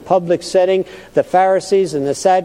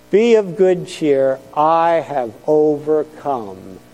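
slower, leaning on "Be", every word weighted; slow emphatic repeat; slower than usual. Acted. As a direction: repeat slowly so it lands. Verse said twice.

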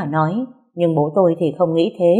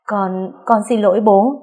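higher, halting plea; frightened apology; 4 semitones higher than usual. A narrator's reading. Daughter apologising to angry father, mildly frightened plea. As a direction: plead haltingly with a touch of fear.

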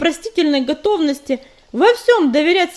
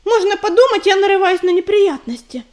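higher, louder retort; snapping back; 5 semitones higher, 6 dB louder than usual. Acted. Belka snaps at Ezhevika's warning, a sharp retort. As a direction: bite back at his reproach. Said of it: "огрызнулась Белка".

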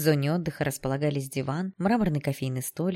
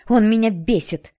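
slow, loud, high-pitched anger; aggressive outburst; 5 semitones higher, 10 dB louder than usual. A narrator's reading. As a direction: hit each word in exasperation. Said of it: "Что за агрессия?". (злая громкая вспышка)

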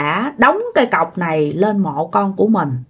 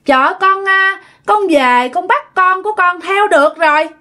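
put-on a lightly raised, pleased girl's voice; satisfied ghost voice; 9 semitones higher than usual. A narrator's reading. Daughter's ghost tells mother, pleased, in a dream. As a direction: sound smugly content calling mother. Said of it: "nói với cái giọng rất là mãn nguyện".

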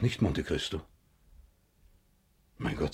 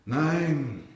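a sharp high cry of no, falling; anguished cry; 9 semitones higher than usual. Acted. Villefort cries out to stop his son's testimony. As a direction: blurt it out in horror. Father cornered by son in court.

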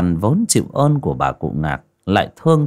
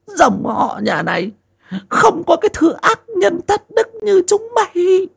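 put-on much higher, shouting old woman's accusation; stern old mother; 3 dB louder than usual. Old mother Ngạc denounces her wicked son, high and shouting. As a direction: rail at your son in a high, cracked old voice. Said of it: "Bà cụ Ngạc đanh mặt".

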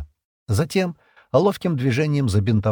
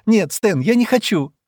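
high, louder, distressed plea; crying out; 11 semitones higher, 5 dB louder than usual. A narrator's reading. Шира refuses the amputation, distressed high cry. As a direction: refuse in panic, close to tears. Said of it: "Шира вскрикнула и снова заплакала".

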